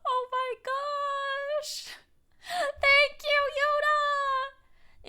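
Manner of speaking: very high, drawn-out squeal; squealed, stretched-out thanks; overwhelmed gratitude. Real, not acted. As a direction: gush thanks in a tiny high voice. Gushing at a supporter's gift.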